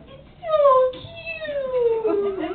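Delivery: very high, long-drawn squeal of delight; gushing cutesy character. Acted. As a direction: squeal it slowly, stretching every word. Honey gushes over bunny.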